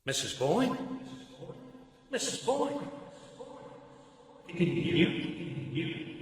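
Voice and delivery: far-off echoing voice as she faints; fading distant call. Acted. Parvis calls to the fainting Mary, faint and distant. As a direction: call her name as if from far away.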